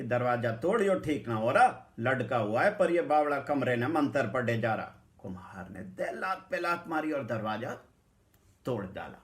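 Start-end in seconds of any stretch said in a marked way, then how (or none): 0.0-4.9 loud, pressing command; urgent order. Retold or imitated. Narrator loudly urging the potter to break the door. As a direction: push him to break in.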